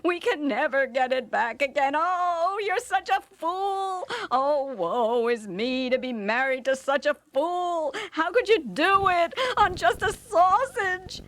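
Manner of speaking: high, shrieking nag with a woeful moan; scolding wife. Acted. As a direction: keep scolding, wailing at being married to a fool. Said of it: "his wife scolded and scolded him".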